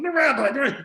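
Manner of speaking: urgently tip off the friend beside you, high-pitched; alarmed boy. Acted. Boy nudges the next one, alarmed warning.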